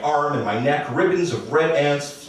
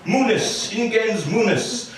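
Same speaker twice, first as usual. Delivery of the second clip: pitched much higher, grandly intoning fake Latin; mock Latin intoning; 9 semitones higher, slower than usual. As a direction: announce it like a pompous museum label. Mock Latin caption inside the story.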